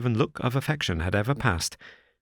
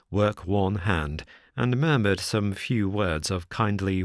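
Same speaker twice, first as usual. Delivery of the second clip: plain reading voice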